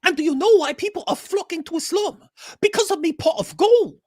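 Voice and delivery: high leprechaun voice; squeaky high Irish leprechaun. Pretend. Lucky Charms leprechaun parody.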